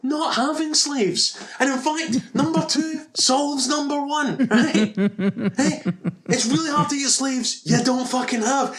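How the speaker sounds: high-pitched indignant lecture; exasperated rant. Real, not acted. Podcaster telling off Brad Pitt's character, exasperated high rant.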